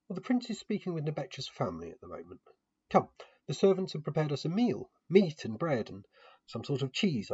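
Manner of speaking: a hint of a gracious hostess inviting to eat. Hostess calling her guest to the prepared meal, lightly voiced.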